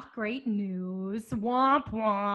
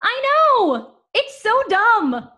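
high, loud, bubbly; mock-cheerful stewardess making light of disaster; fake chirpy; 9 semitones higher, 6 dB louder, slower than usual. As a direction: chirp it like it's trivial news. Stewardess chirps about sinking ship.

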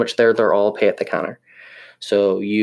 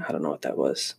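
normal talking voice

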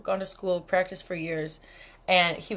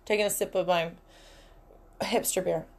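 usual speaking voice

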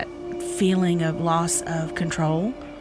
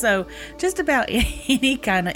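laughing; 6 semitones higher than usual. Real, not acted. Laughs while listing own sleep troubles.

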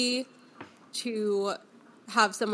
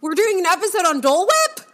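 surprised; 9 semitones higher, 9 dB louder than usual. Real, not acted. Surprised by episode topic.

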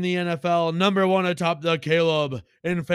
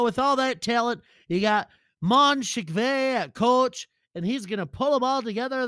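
Lenny voice; 6 semitones higher than usual. Acted. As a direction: boast like a trash-talking sidekick. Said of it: "Lenny Poker has picked his".

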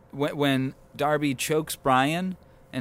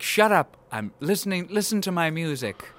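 higher, mock-annoyed snap; irritated brush-off; 4 semitones higher than usual. Host voices him shushing Brian, mock irritated. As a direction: tell off a pest, pitch raised.

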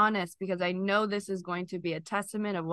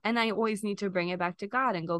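ordinary talk

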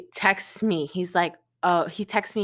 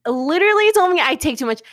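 outraged; 9 semitones higher, 7 dB louder than usual. Real, not acted. Outraged at ex's words.

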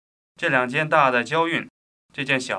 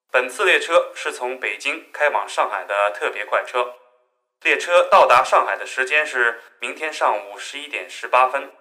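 formal announcer voice, a bit louder; train announcement; 5 dB louder than usual. Acted. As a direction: address the passengers like a train PA.

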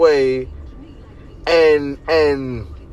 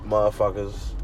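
usual speaking voice

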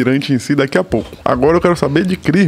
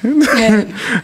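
risada curta e aguda; 7 semitones higher than usual. Real, not acted. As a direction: solta uma risadinha alta. Risada após o apelido. (risadinha aguda e rápida)

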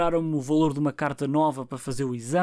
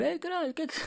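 mock annoyed listener; 13 semitones higher, 5 dB quieter, faster than usual. Listeners annoyed by long episodes.

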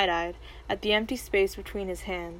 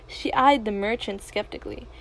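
ordinary delivery

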